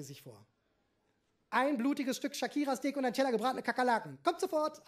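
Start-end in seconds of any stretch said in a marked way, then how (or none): 1.5-4.9 higher, louder waiter voice tossed off in passing. A narrator's reading. Waiter passing the table, a brisk calling voice. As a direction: call the order back as you hurry by. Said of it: "antwortete der Kellner im Vorübergehen".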